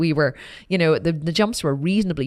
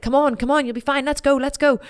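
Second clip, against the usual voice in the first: high, louder coaxing; eager urging; 8 semitones higher, 3 dB louder than usual. Retold or imitated. Girl urging sister up the trail, high and eager. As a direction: hurry her along eagerly.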